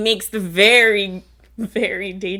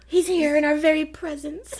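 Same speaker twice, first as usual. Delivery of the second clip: higher, breathy theatrical reveal; dramatic queen; 8 semitones higher than usual. Retold or imitated. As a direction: reveal the villain with relish. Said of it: "dramatic sigh".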